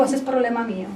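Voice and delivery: low, soft, matter-of-fact reply; God's voice. Retold or imitated. God answers her worried question, low and quiet. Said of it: "El Señor me dijo".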